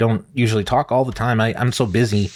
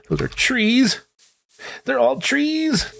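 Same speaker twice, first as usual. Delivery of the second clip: much higher and slow, alarmed; lost and scared in the dark; panicked; 13 semitones higher, much slower than usual. Real, not acted.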